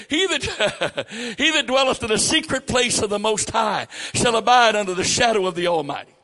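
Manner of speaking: laughing start, then loud, high proclaiming of "the secret place"; scripture reading. Real, not acted. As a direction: proclaim the verse to the church.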